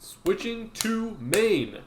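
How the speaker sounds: slow, high announcer call. Acted. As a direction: stretch it like an announcer. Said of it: "announcing the switch".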